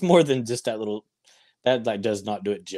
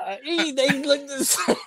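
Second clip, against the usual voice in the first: laughing. Acted. Mocking reaction, laughing.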